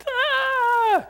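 high yelled ah; mock scream. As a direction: yelp like chaos broke out. Kids bouncing off walls.

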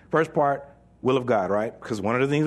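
normal talking voice

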